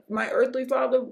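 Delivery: ordinary talk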